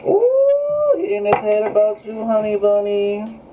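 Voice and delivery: teasing high "honey bunny" croon; sassy high gush. Acted. Gushing reading exclamation.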